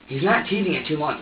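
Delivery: usual speaking voice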